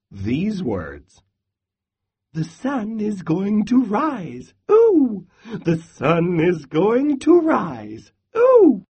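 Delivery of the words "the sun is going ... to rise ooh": much higher squeaky squirrel, squealing ooh; excited squirrel. Acted. Squirrel answers Bear, high with a squealing ooh. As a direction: chirp it out hopeful and eager. Squirrel chants all night.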